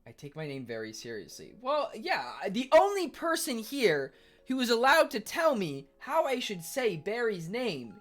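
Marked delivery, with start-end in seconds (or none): 1.6-8.0 high, loud emphatic declaration; loud emphatic rant; heated insistence. Real, not acted. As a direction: insist on it firmly.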